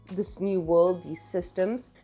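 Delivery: ordinary delivery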